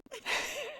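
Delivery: high-pitched giggle. Real, not acted. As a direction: giggle softly. Laughing at the story.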